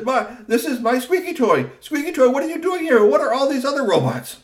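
high, loud amazement; finding his own toy here; astonished professor. A narrator's reading. Talltail seeing Squeaky Toy, astonished. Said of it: "Doctor Tall Tail said".

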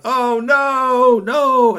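high, loud cry of alarm; dismay. Acted. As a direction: wail at the broken engine. Vendor sees broken engine.

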